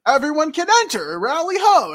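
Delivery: loud, high proclamation; welcoming call. Acted. Villagers let the group in, loud high welcome. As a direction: announce it high and loud to all. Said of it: "And they're like".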